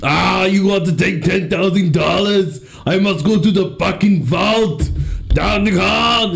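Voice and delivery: mock Austrian banker heading to the vault; Austrian accent. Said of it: "He's speaking Austrian again".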